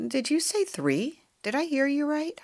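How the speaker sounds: high-pitched, stunned questioning; incredulous. A narrator's reading. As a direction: ask it high, as if you can't have heard right. Mom reacts in disbelief.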